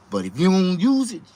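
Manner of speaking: ordinary talk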